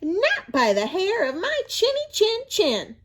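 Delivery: high, defiant little javelina; second javelina crying out. A narrator's reading. Second javelina defying Coyote, high and firm. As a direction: cry out the refusal, small and high. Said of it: "The second one cried".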